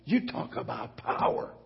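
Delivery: breathy, half-whispered echo; a preacher's hushed echo of his own question. Acted. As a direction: drop to a near whisper.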